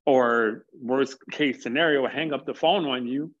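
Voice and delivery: ordinary talk, nothing marked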